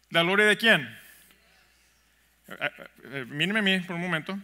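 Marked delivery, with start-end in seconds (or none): none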